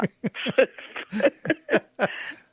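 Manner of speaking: a high, bright burst of laughter. Real, not acted. Amused at her bizarre childhood.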